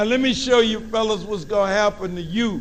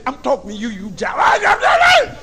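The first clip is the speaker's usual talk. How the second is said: mama rising to a high yell; angry mama shout; 8 semitones higher, 4 dB louder than usual. Retold or imitated. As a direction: scold him, climbing into a screech on jive. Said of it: "your mama will say".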